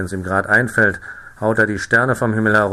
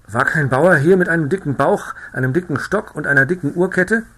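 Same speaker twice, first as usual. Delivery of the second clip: breathless rider, higher and louder; out of breath; 6 semitones higher, 5 dB louder than usual. A narrator's reading. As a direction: gasp the question out after the ride. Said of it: "fragte ganz außer Pust". (atemloser Reiter, höher und lauter)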